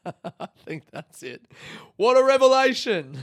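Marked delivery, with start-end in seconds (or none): 2.0-3.2 loud, high-pitched sarcastic exclamation; hammed-up astonishment at yourself; big self-mocking cry; mock amazement. Real, not acted. Mocks own realisation amid laughter.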